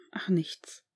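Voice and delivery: trailing whisper of nothing. A narrator's reading. Harry whispers to his father, breaking off what he meant to say. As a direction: breathe it out, giving up.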